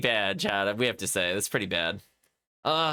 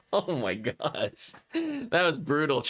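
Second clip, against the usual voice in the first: ordinary talk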